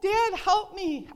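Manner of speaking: retold scream. Waking from a choking nightmare, calling for her father, loud cry. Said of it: "I'm screaming".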